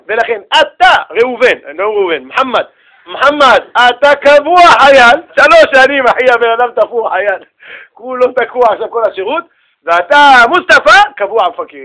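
mock army officer's loud announcement; recruit sorting officer. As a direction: call out assignments with force.

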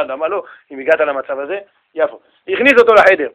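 ordinary talk

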